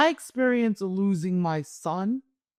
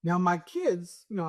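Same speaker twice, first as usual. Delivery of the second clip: ordinary delivery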